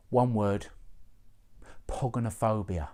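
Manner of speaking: ordinary talk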